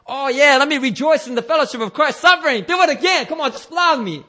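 high, hammed-up glee; mock eagerness. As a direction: beg for it with absurd enthusiasm. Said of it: "It wasn't like".

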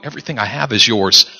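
a father gently reassuring his son; tender father. Retold or imitated. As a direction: soften into a loving promise. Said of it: "You hear what he says?".

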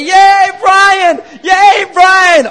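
shrieking high cheer for the underdog; mock crowd cheer. Fan cheering on churchmate Brian at fight, mock shout. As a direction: scream it like a fan ringside.

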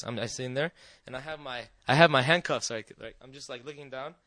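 subdued, head down. Retold or imitated. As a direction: drop to a small, downcast voice. Acting out sitting ashamed, head down.

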